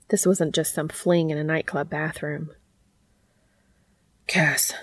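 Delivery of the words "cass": whispery, breath-filled address; breathy. Acted. Dean checks with Cass before going further, breathily. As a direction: say the name on a breath. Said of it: "he asked breathily".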